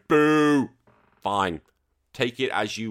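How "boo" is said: mock boo. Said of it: "some people might be going".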